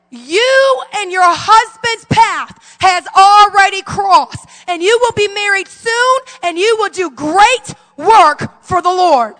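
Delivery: loud, slow prophetic declaration; loud prophecy. Retold or imitated. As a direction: proclaim it slowly over her. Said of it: "in his South African accent".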